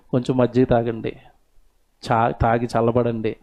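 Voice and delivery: calm her angry husband down softly; soft gentle words. Retold or imitated. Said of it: "మృదువుగా మాట్లాడితే". (మృదువుగా, మెల్లగా భార్య మాట)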